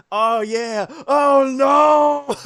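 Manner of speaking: high, loud mock glee tipping into a wail; hammed-up cry of doom; hapless green guy. Streamer acts the game character getting shot down, mock wail. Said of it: "poor green guy's like".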